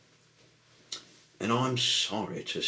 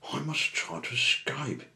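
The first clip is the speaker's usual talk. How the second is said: high, louder tugboat voice; urgent, raised-pitch character line; determined captive; 10 semitones higher, 5 dB louder than usual. A narrator's reading. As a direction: resolve aloud to break free. Captive Sunshine resolves to escape.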